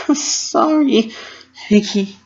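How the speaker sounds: high, slow, voice near breaking; tearful apology. A narrator's reading. Dash apologizing to Pinkie, choked and tearful. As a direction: apologize while holding back tears.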